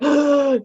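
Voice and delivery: sharp, loud, high gasp of shock; retold gasp. Seeing the tuna can, a loud shocked gasp. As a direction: gasp as if something foul appeared.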